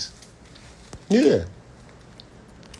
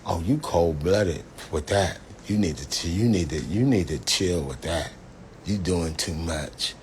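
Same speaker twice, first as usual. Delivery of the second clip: lower, softer scolding mama voice; imitating mama; 6 semitones lower, 6 dB quieter than usual. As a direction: gently tell your son to chill, low and soft. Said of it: "my mama be like this".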